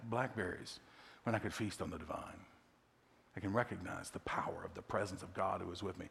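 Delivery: hushed, breathy whisper of awe; soft awed whisper to the congregation; reverent whisper. Real, not acted. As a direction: whisper it like a prayer. Reverent hush during sermon.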